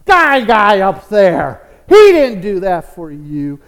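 loud, challenging call; acted neighbor loudly contradicting a believer; scoffing atheist. Preacher acts a scoffing atheist neighbor.

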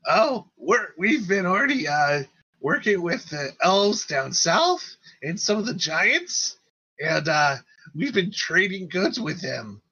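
high-pitched, bouncy character voice; small creature chattering up high; eager little leader. Acted. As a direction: pitch it well up and report eagerly. GM plays Spit, tribe leader.

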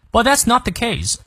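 But that's not the case with not clearly stressed. Shown as a demonstration. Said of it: "not重读".

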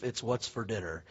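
deep, hushed ad-announcer tagline; mock TV ad voice; commercial voiceover. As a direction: drop low and soft like a beef commercial. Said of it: "discontinue the commercial".

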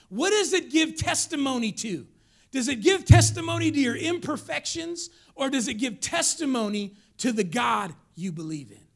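forceful preaching. Real, not acted. Preacher challenging church about their testimony, forceful.

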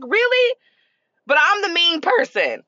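high-pitched mock incredulity; incredulous retort on the edge of laughing; amused disbelief. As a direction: pitch it way up, playfully indignant. Mocks daughter's silent treatment.

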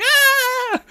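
a dweeb's high scream; high panicked yell. Retold or imitated. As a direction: shriek like someone losing control downhill. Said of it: "yelling down the hill".

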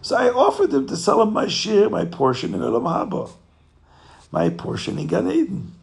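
hammed-up tickled innkeeper; giddy amusement. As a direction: tell the joke like it's too funny. Innkeeper giddy, telling wife the joke.

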